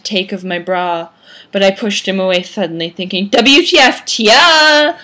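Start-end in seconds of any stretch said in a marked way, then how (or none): 3.3-5.0 louder, higher, incredulous squawk; over-the-top teen indignation; mock outrage. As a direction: blurt it out in hammed-up disbelief. Narrator's thought, mock outrage.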